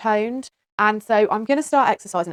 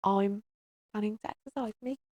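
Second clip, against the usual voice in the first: much softer, sheepish; meek mumble; 8 dB quieter than usual. Pretend. Mother repeating her plan to viewers, sheepish quiet mumble. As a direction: say it timidly under your breath. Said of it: "Who said that?".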